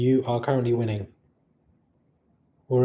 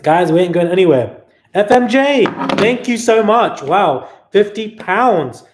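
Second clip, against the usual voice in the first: excited thanks; 7 semitones higher, 10 dB louder than usual. Real, not acted. Thanking donors for super chats.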